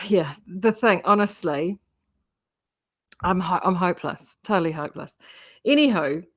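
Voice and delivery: ordinary delivery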